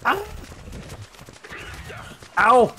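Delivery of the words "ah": usual speaking voice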